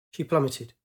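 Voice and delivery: ordinary delivery, nothing marked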